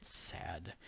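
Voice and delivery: whispered mock sorrow. Real, not acted. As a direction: breathe it out, no voice. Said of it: "whispered reaction".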